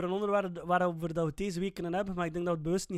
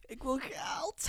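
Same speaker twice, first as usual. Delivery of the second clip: drawn-out greedy; 7 semitones higher, 5 dB quieter, much slower than usual. Real, not acted. Blunt joke about wanting money.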